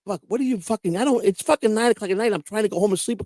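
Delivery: exasperated protest. Retold or imitated. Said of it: "And I'm like".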